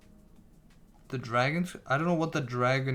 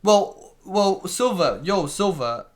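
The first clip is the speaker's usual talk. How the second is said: loud call to Silver, higher pitched; 5 semitones higher, 7 dB louder, slower than usual. Real, not acted. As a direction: shout across the room to get his attention. Calling out to a friend.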